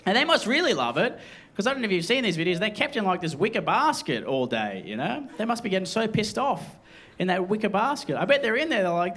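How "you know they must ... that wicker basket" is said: normal talking voice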